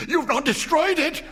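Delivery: high, loud urgent question. A narrator's reading. Wife pressing her husband about the paw, high and urgent. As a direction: press him for it, frantic.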